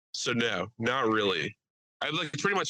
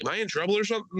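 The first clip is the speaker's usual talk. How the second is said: higher, worried voice; mock nervous; 9 semitones higher, much faster than usual. Driver nervously asking the officer, higher. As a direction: ask like a nervous kid.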